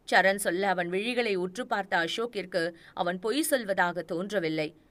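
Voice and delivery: ordinary delivery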